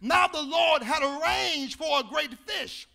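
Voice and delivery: shouted preaching over organ; preaching at full force. Acted. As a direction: preach it loud to the crowd.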